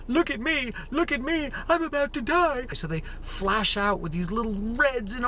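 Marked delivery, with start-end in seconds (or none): 0.1-2.7 much higher, whiny pleading voice; a whiny baby begging for attention; whiny trees. Acted. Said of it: "They're saying".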